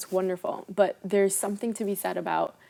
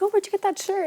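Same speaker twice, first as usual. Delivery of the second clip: much higher, curious mock voice; imitating curious people; 12 semitones higher, 4 dB louder than usual. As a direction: playfully mimic someone admiring the shirt. Imitating people asking about clothes.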